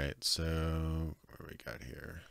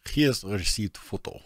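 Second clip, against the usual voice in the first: mock Russian accent, echoing the game; deadpan Russian accent repeat; 3 semitones higher, 6 dB louder than usual. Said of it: "your Russian accents".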